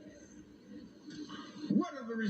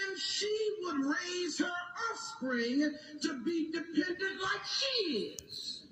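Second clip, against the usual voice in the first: full-force preaching; 10 semitones higher than usual. Acted. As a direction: preach it to the rafters.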